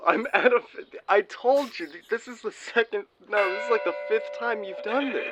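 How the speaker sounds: high, loud mock exasperation; hammed-up fed-up friend on the phone; exasperated friend.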